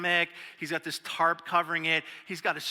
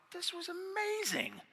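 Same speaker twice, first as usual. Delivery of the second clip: amazed; 12 semitones higher, 8 dB quieter than usual. Retold or imitated. Surprised converts after camping.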